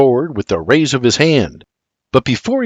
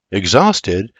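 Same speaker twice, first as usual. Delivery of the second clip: usual speaking voice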